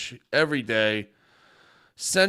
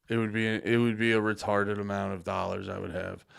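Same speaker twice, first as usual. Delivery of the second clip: usual speaking voice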